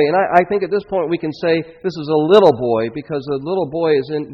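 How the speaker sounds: ordinary talk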